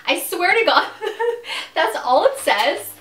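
laughing loud and high through the words; can barely get it out for laughing. Real, not acted. Telling viewers about the letter, laughing loudly.

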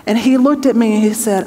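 usual speaking voice

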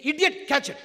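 a firm loud jab; mock insult. Shown as a demonstration.

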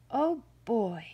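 hungry bear's mildly excited exclamation; eager relish. A narrator's reading. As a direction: gloat over a coming meal, voicing a hungry bear. Bear gleeful at finding supper.

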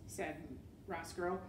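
usual speaking voice

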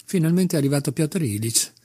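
usual speaking voice

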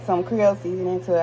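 ordinary talk

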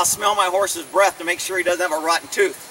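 ordinary talk

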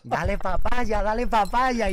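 voz aguda, lenta y fuerte, imitando a la chica del concierto; imitando a una mujer. Retold or imitated. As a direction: canturrea la frase estirándola, burlón. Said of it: "y decía". (imitación aguda y lenta de una fan gritando)

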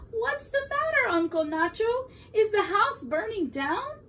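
high, loud, worried questioning; little niece's urgent high voice; alarmed niece. A narrator's reading. Niece alarmed at smoky house.

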